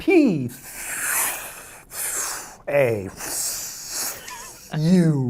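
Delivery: high, emphatic single letters, falling off; punched-out skywriting letters. Acted. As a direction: fire off each letter like a rocket burst. Narrates letters being written in sky.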